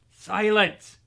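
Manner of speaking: loud, high yell. A narrator's reading. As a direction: bark the single word. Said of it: "yelled Uncle Vernon".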